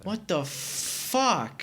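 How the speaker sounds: high, very drawn-out disbelief; appalled disbelief. Real, not acted. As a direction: drag out each word, truly aghast. Horrified at hearing the baby died.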